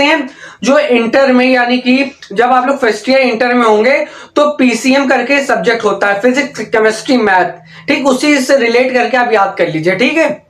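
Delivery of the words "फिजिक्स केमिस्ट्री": ordinary talk, nothing marked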